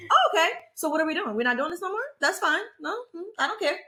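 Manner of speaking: bright, high fake-polite customer service voice. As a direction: switch to a sweet customer service voice. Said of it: "that's my customer service voice".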